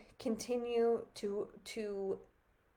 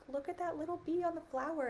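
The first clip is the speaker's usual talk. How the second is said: much higher, imitating a small child's excitement; daughter's voice; 8 semitones higher, 3 dB quieter than usual. Mother imitating her daughter spotting a bee, higher child voice. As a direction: lightly voice a little girl pointing something out. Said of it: "my daughter was like".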